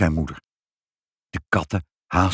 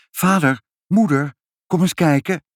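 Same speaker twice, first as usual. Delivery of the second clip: high, louder call to wake the parents; child calling out; 9 semitones higher, 4 dB louder than usual. A narrator's reading. Ibbeltje wakes the parents at their bedside, calling out. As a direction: call excitedly for Mum and Dad. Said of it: "aan hun bed stond en riep".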